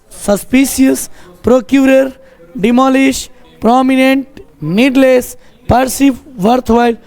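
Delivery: high, level chant-like word list; word-list recital. Shown as a demonstration. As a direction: recite the list steadily for the class. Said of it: "क्या बोलोगे?".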